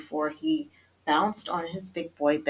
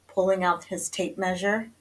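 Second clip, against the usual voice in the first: ordinary delivery, nothing marked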